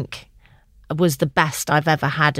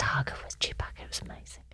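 hushed conspiratorial whisper; 16 dB quieter, faster than usual. Real, not acted. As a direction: lean in and whisper a secret. Whispering the hug story.

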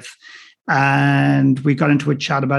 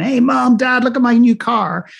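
much higher, louder, showing off to mom and dad; mock show-off son; 9 semitones higher, 3 dB louder than usual. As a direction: call out to the parents like a bragging kid. Mock show-off calling parents.

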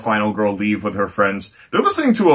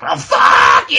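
loud, hammed-up tearful yell; over-the-top wailing outburst; mock furious shout; 8 dB louder, slower than usual. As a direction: scream it in mock hysterics. Acting out friend's overblown outburst.

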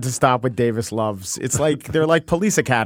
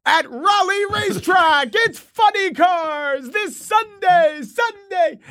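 high, slow screaming racetrack ad; monster truck announcer; slower than usual. Pretend. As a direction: bellow a Sunday Sunday ad. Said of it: "racetrack bit".

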